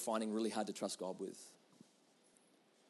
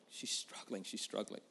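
whispered repeat; 5 semitones lower, 9 dB quieter than usual. Shown as a demonstration.

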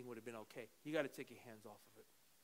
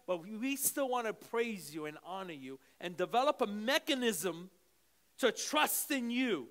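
loud, high-pitched, impassioned preaching; fervent preaching prayer; 5 semitones higher, 11 dB louder than usual. Acted. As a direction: pray over the congregation with full force. Fervent prayer over music.

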